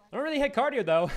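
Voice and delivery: high, loud confession breaking into laughter; laughing admission. Real, not acted. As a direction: admit it loudly and burst out laughing. Laughing admission about skipping cardio.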